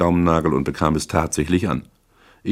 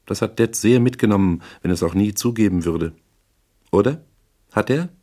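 higher-pitched woman character, chatty and probing; probing client; 4 semitones higher than usual. Acted. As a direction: talk on, then press for an answer. Voices Misses Regan in narration.

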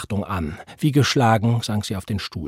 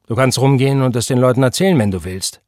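quick, loud bravado; brash challenge that drops at the end; bold; 3 semitones higher, 7 dB louder, faster than usual. A narrator's reading. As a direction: toss it out as a bold dare. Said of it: "sagte er kühn".

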